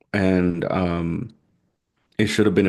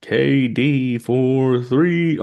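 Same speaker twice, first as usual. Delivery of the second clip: slow, drawn-out, higher announcer call; arena announcer; 5 semitones higher, much slower than usual. Pretend. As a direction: stretch it out like a PA announcer. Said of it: "like a, an arena announcer".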